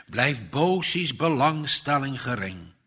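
ordinary delivery, nothing marked

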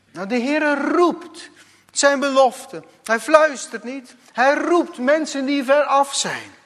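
high and loud, proclaiming; preaching at full force. Acted. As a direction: proclaim that God calls. (luid verkondigend preken)

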